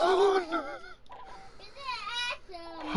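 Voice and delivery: high, helpless peal of laughter; real giggling fit; clownish laugh. Real, not acted. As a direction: keep cracking up in a high pitch. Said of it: "I sound like a goddamn clown".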